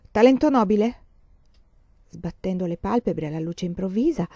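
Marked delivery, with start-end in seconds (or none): none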